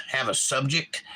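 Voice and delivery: normal talking voice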